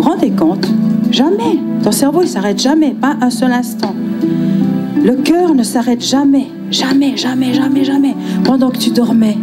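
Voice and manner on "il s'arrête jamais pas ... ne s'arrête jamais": ordinary delivery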